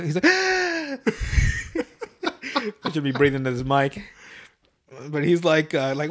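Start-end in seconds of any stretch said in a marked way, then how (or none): 0.3-2.8 long, high cartoon gasp; drawn-out exaggerated intake of breath; mock gasp. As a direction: gasp hugely in mock horror. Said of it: "make Carmen gasp".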